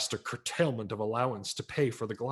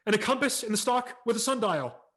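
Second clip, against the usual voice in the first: loud, much higher rushed shout; shouted wish; 11 semitones higher, 7 dB louder than usual. A narrator's reading. The boy finally telling Santa his wish, shouting. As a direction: blurt the whole wish in one burst. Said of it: "I shouted".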